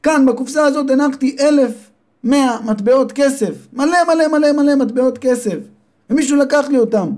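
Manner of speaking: high, upset mother's voice. Acted. Mother tells her son the money is gone, high and upset. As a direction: wail it like a robbed mother.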